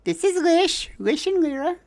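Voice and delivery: very high squeaky introduction; shy rat folk. Acted. Fidget introduces itself and its friends, very high shy squeak. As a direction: squeak it out timidly like a tiny rat. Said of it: "Fidget's like".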